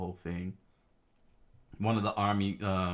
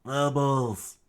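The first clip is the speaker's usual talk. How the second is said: higher, loud acted cry; struggling scream; 6 semitones higher, 7 dB louder than usual. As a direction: call out to the fighters. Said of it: "able to scream out loud".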